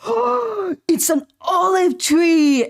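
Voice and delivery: high, slow, loud gasping wonder; imitating excited girlfriend. Imitating girlfriend amazed by an olive tree, high excited squeal. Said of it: "And she freaked out".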